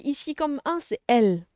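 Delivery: usual speaking voice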